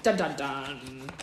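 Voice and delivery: low, slow mock suspense sting; dramatic sting. Reader capping the chapter's cliffhanger, mock-dramatic. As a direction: intone it like cliffhanger music.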